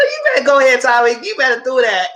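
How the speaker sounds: very high, loud taunting voice; sassy taunt. Retold or imitated. Viewer cheering Tasha on, high and sassy. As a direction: egg him on with sass. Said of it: "I said".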